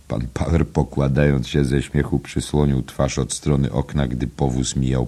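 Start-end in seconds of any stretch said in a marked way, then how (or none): none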